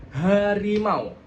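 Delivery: "harimau" with a pushed H and open A; harimau, H jelas. Shown as a demonstration. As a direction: articulate "harimau" slowly. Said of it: "ada sedikit getarannya". ("harimau" dengan H bergetar di dada)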